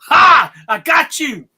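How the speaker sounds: loud, high "gotcha" burst; crowing, playful shout; mock triumphant shout. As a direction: pounce on it like springing a trap. Springs trap in joking retelling.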